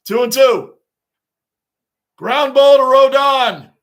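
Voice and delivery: loud, high shout at the play; excited play call. Real, not acted. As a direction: belt out the call, stretching the player's name.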